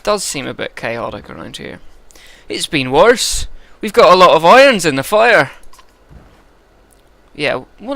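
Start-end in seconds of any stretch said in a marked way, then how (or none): 2.5-5.5 higher, louder West Country steward; proud steward in a thick West Country accent; West Country Eirik. Acted. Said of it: "I think West Country for this guy".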